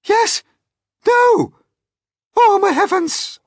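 loud, high alarmed exclamations; panicked cries. Acted. Travellers crying out as disaster looms, panicked. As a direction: cry out in rising alarm.

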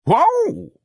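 high, loud whoa whoop; big astonished holler; janitor's whoop. Acted. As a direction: let out a falling falsetto whoa.

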